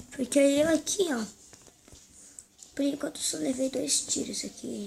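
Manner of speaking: ordinary talk, nothing marked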